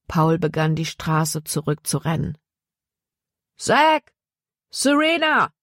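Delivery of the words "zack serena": calling out names. A narrator's reading. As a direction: shout their names to reach them. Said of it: "rief er".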